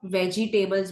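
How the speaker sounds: वेजिटेबल्स spelled out as "VE-jee-TAY-bulz"; wrong pronunciation. Shown as a demonstration. Teacher shows how people mispronounce it, wrong long pronunciation. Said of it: "अगर आप wrong pronounce करते हैं".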